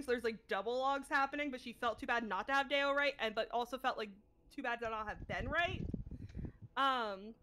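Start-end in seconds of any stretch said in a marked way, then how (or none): none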